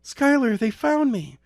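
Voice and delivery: put-on high, loud panicked girl's cry; a girl caught, panicking; terrified girl. A narrator's reading. Kidnapped girl as they find her, high and loud.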